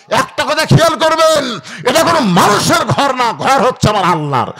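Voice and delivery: high, loud sermon cry; full-force preaching. Real, not acted. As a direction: drive it home to the whole crowd. (জোর গলায় ওয়াজ)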